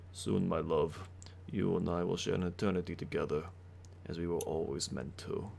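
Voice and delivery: low, intimate vampire whisper-tone; vampire lord voice. Acted. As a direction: speak as the vampire to his love. GM voices Strahd to Marina.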